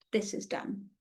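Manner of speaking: breathy whispered inner thought; whispering. Retold or imitated. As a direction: whisper it to yourself, resolute. Inner resolve, voiced as a thought.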